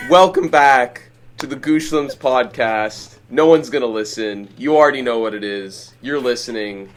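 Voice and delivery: podcast host intro. Acted. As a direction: open the show like a host.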